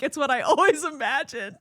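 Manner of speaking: laughing Mary Shelley quote. Retold or imitated. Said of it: "Mary Shelley, like".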